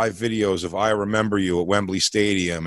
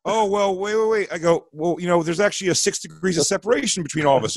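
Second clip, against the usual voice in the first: higher, rushed save; panic move; 6 semitones higher than usual. Retold or imitated. Comic to an offended rock singer, higher panicked save. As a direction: scramble to change the subject. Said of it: "in a panic move".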